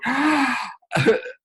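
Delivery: high, hammed-up frightened gasp; mock gasp. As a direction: imitate a scared public gasping. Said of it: "everybody's going".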